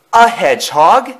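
high, loud disbelief; surprised yell. A narrator's reading. Thomas opens an eye, high surprised yell. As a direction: yell it in astonishment. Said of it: "he yelled in surprise".

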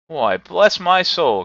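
loud, high, drawn-out exclamation; astonished cry. A narrator's reading. Fred finds his uncle at the door, crying out amazed. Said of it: "cried Fred".

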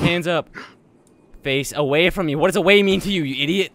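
loud, impatient barked orders; exasperated shouting. Pretend. Cop berating a suspect who won't turn, exasperated shout.

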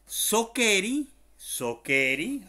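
"Sokeri" drawn out slowly, loud and rising; pronunciation demo. Shown as a demonstration. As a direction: model "Sokeri" slowly and loudly for learners. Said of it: "Así como suena también". ("Sokeri" lento, fuerte y con entonación ascendente)

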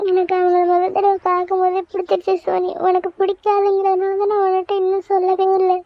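put-on a girl confesses to her best friend while crying. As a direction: plead through sobs to her friend. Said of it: "Soumi அழுகுறா".

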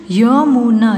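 Yamuna with each sound held; syllable by syllable. Shown as a demonstration. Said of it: "फिर ये सारे बोलने". (यमुना को तोड़-तोड़ कर)

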